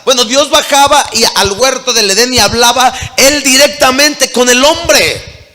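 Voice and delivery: loud, high preaching at full force; preacher driving the call home, loud and high; fiery preaching. Acted. Preacher urging the congregation, loud and high, at full force.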